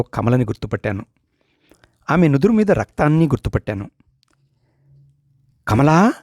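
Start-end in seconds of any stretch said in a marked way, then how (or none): 5.7-6.1 startled, alarmed call of her name; frightened cry. A narrator's reading. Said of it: "అన్నాను భయభ్రాంతుడినై". (భయంతో పిలుపు)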